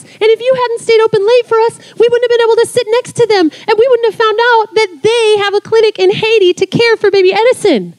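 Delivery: beaming storyteller's thrill; excited telling. Retold or imitated. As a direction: pour the good news over them.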